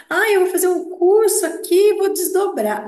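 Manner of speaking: high, loud, mock-excited voice; mock enthusiasm, pitched high and loud; mocking eager newbie. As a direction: parody someone sure a course will get them out of their body. Mocking people taking online courses.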